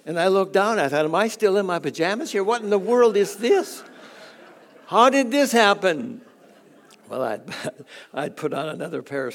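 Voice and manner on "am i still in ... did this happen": rising, louder, hurried disbelief; half-laughing retold amazement; mock astonishment. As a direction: act out the shock of seeing your own pajamas. Retelling finding pajamas on hanger.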